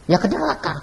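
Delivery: high, soft voice of a frightened woman; small, high, fearful reply, acted out; takut. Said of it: "Makanya aku takut". (suara kecil tinggi, pura-pura takut)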